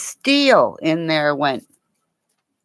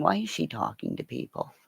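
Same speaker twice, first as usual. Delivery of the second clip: dog's inner voice; 10 dB quieter than usual. Pretend. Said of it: "Diesel's going".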